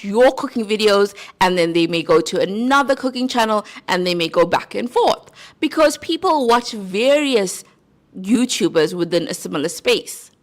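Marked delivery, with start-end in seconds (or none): none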